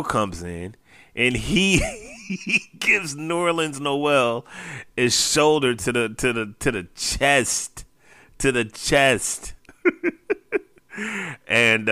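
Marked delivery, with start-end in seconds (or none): 1.1-9.5 laughing through the words; chuckling as he tells it. Real, not acted. Laughing while telling the shove. 9.8-10.6 high burst of laughter. Real, not acted. Laugh after the story.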